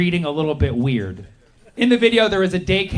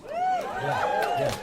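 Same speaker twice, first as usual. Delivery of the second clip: mock cheer; 5 dB quieter than usual. Faked celebratory yeah.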